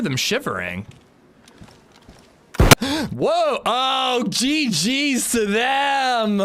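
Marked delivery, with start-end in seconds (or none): 2.5-3.1 sharp gasp. Real, not acted. Sudden death in game. 3.1-6.4 high, slow shouted exclamation; excited shout. Real, not acted. Killed by landmine, stunned.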